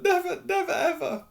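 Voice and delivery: very high tearful elf wail. Acted. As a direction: cry it out, overwhelmed. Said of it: "he wailed".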